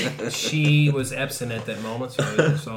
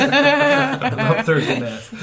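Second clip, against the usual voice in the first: laughing; 12 semitones higher, 8 dB louder, much slower than usual. Real, not acted. Laughing at Thursday math joke.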